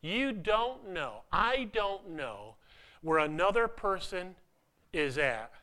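slowed, weighty delivery; deliberately slow, driving the point; slow emphasis. Real, not acted. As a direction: lean on each word slowly.

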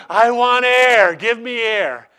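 drowning disciple crying out to Socrates; desperate plea for air. Retold or imitated. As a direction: beg for breath like a man half drowned. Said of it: "he says".

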